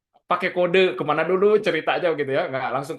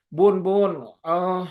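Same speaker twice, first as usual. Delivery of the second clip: higher, lightly voiced upset child; 5 semitones higher, slower than usual. Child telling mother about being bullied, higher upset child voice. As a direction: play a child telling mum about bullying.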